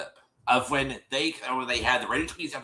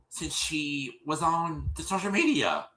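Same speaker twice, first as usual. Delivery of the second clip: ordinary talk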